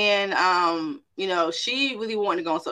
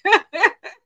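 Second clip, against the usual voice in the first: sudden high laugh; 12 semitones higher, 8 dB louder than usual. Real, not acted. Preacher laughing at her own story, a high laugh. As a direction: burst into a laugh.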